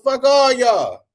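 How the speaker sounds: imitating his younger, furious self; retold inner rant. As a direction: rant like you're outraged at the paddle. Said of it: "I'm thinking to myself".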